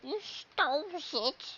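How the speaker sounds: high, slow squeaky voice; squeaky accusation. Pretend.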